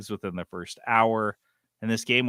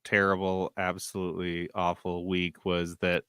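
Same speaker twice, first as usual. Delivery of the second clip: usual speaking voice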